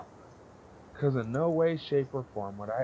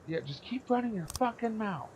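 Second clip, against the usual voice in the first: higher, snide imitation of the boss; mock boss voice; 11 semitones higher than usual. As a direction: play the boss sneering down at him. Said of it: "And she goes".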